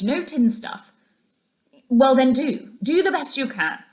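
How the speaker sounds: lightly voiced brisk orders; fussy shopping instructions; a hint of Rat bargaining over the shopping; Rat giving orders. A narrator's reading. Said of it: "muttered conversation".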